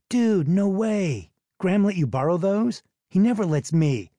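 put-on wide-eyed jealous kid; stretched-out disbelief from a young boy; excited kid. Kid amazed by borrowed boots.

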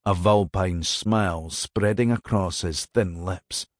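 ordinary delivery

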